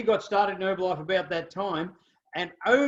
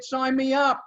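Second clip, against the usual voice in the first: higher, louder eager exclamation; eager sign-up; 6 semitones higher, 3 dB louder than usual. Retold or imitated. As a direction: jump at the offer. Eager reply ending the story.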